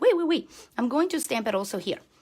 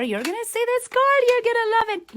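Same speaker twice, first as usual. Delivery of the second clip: excited; 14 semitones higher, 6 dB louder than usual. Real, not acted. Excited promise to viewers.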